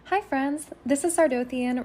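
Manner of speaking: ordinary delivery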